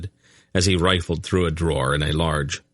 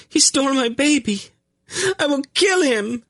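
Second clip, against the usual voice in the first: very high, frantic fury; high-pitched hysterical rage; enraged mother. A narrator's reading. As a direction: shriek it like a mother robbed of her child. Enraged mother attacks the prisoner.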